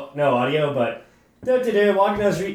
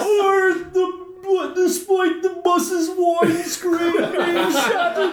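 high, slow, hammed-up British hooligan voice; British hooligan accent; 9 semitones higher, slower than usual. Friends egg him on to read it as a hooligan, hammed-up British accent. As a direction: lay the fake cockney on thick. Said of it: "What accent is that?".

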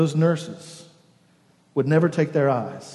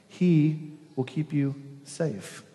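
slow, soft, tender promise; slow reassurance; 6 dB quieter, much slower than usual. Shown as a demonstration. Preacher comforting the congregation, slow and soft. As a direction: draw out "He will keep you safe" gently. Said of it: "I need you to hear".

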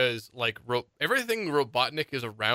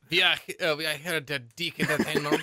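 put-on French studio spokesman; mock French accent. Joking as the animation studio's staff, mock French accent. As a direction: play it in a hammy French accent. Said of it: "with this French accent".

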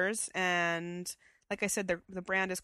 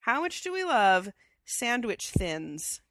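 announcing, slow; 5 dB louder, slower than usual. Acted. Announcing the show's segment.

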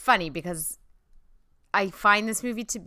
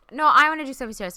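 louder, faster mock insistence; Sinclair's insistent reply; 8 semitones higher, 11 dB louder, faster than usual. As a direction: bulldoze over her, teasing. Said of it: "Sinclair, you were like".

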